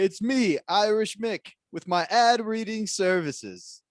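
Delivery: high, loud failed Irish brogue; badly faked Irish accent, slow on the last line. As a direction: try an Irish ad voice and miss. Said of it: "I can't do Irish".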